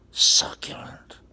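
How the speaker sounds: slow, hushed whisper of delight; savoring whisper. Acted. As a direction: whisper it slowly like a delicacy. Savoring the bread word, whispered.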